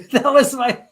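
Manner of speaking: words broken up by a real laugh. Real, not acted. Host laughing while reading a viewer's teasing comment aloud. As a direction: laugh through it, high and bright with amusement.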